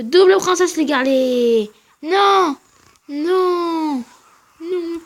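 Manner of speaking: loud, high yelling at the screen; real gamer outburst, loud and high; excited shout. Real, not acted. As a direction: shout the play, then cry no. Excited then dismayed at the game.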